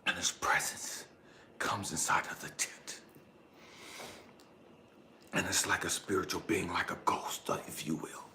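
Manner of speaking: low, very soft narration; hushed storytelling. A narrator's reading. Telling how a spirit enters the tent, hushed. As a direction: draw listeners in with a near-whispered hush.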